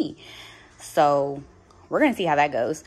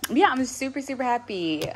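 higher-pitched, pleased delight; super happy; 7 semitones higher than usual. Real, not acted. Vlogger relieved her phone update is done, happy. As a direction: let the happiness lift your voice. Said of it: "I'm so happy I finally got it done".

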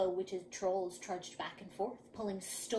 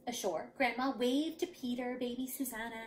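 ordinary delivery

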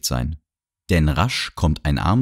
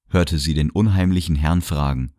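ordinary delivery

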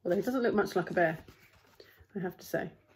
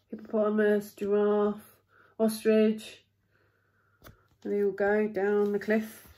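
ordinary delivery

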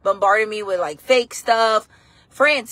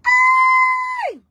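high, loud sudden "Hey!" called out; shouted greeting; 4 dB louder than usual. Real, not acted. Streamer yelling hey to viewers.

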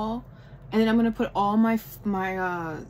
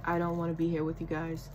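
low, quick, softly grumbled character quote; lightly voiced grump, dropped low and quiet; grumpy Squidward; 5 semitones lower, 5 dB quieter, faster than usual. Vlogger voicing the angry cartoon character on her portrait, lightly grumpy. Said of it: "he is just so angry".

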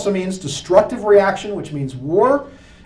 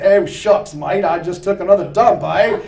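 hammed-up Australian bloke, louder; mock Australian accent; 5 dB louder than usual. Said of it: "an Australian goes".

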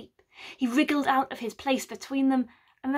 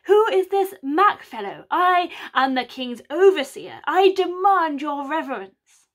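put-on overblown posh southern accent; 4 semitones higher, 6 dB louder than usual. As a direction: play a pompous fake lord. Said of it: "Mac's southern accent is so overblown".

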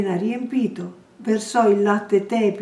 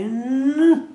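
high, drowsy half-grunt; sleepy grunt; 5 semitones higher, 4 dB louder than usual. A narrator's reading. The wife answers Bloom from bed with a sleepy grunt. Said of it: "Un debole grugnito assonnato".

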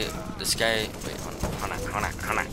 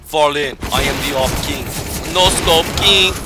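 loud, grand proclamation, rising higher; hyped self-proclamation; 4 semitones higher, 8 dB louder than usual. Pretend. Player crowning themselves before the match, hyped up. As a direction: announce yourself like a champion, loudly.

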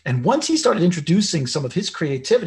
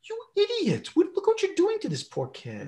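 much higher, put-on scolding voice; mock inner critic voice. Father's inner voice scolding him for upsetting his son, much higher. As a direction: squeak it out like a nagging inner critic. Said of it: "hear my words and my tone of voice and go".

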